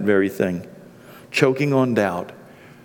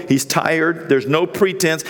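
normal talking voice

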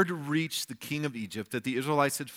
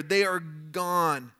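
slow emphasis; 3 semitones higher, much slower than usual. Shown as a demonstration. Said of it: "emphatic slow delivery".

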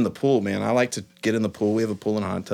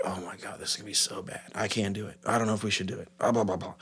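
anxious inner voice; 3 semitones lower, 10 dB quieter, faster than usual. Retold or imitated. Retelling his own early fears.

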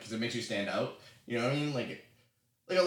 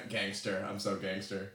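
low, soft mock swagger; nerdy gangster; 4 semitones lower, 6 dB quieter than usual. Speaker impersonating a platoon mate, nerdy gangster swagger. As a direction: nerd playing gangster, pitched down. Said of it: "like, nasal nerd thing".

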